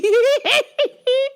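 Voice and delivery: high burst of laughter. Real, not acted. Comic laughing about her dad, high laugh.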